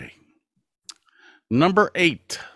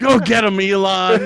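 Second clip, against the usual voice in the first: laughing; 8 semitones higher, 8 dB louder than usual. Real, not acted. Cheering while still laughing.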